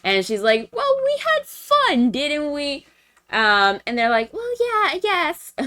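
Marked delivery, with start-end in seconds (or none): 0.7-2.9 very high, slow singsong voice; sweet angel imitation. Voicing sweet Miss Anna. 4.3-5.5 high put-on little voice; imitated friends' reply. Voicing friends' answer.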